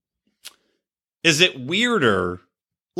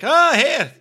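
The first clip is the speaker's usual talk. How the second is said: high-pitched mock Irish greeting; Irish accent; 10 semitones higher than usual. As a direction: put on an Irish potato seller. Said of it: "there was an Irish guy".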